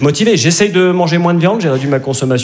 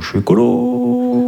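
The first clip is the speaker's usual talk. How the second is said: high voice; 10 semitones higher, much slower than usual. Acted. Repeating slogan in high voice.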